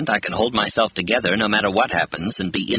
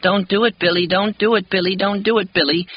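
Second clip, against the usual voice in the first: put-on pitiful child's plea; 11 semitones higher than usual. A narrator's reading. Little boy begs Billy to stop, high pitiful plea. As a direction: beg like a frightened small boy. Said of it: "saying in the same pitiful voice".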